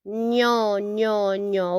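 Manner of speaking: ño repeated with a ny-like ñ; teacher drilling the ñ sound. Shown as a demonstration. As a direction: drill the ño syllable. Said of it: "drilling the ñ syllable".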